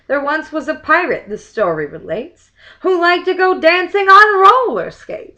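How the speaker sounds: lively verse recital; limerick reading. Acted. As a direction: recite it like a party rhyme. Reads limerick aloud.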